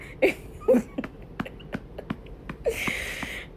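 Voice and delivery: soft, airy laugh into silence; breathy laugh. Real, not acted. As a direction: laugh quietly at the memory. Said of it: "I'm still high in my mind".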